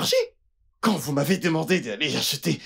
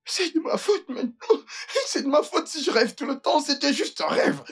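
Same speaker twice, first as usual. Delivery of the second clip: whiny protest; 11 semitones higher than usual. Acted. Servant complaining after scolding.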